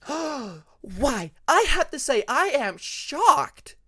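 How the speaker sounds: hammed-up offended, high and loud; mock scandalized protest to her friends; mock hurt. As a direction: play wounded innocence, slowly. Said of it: "her mock hurt tone".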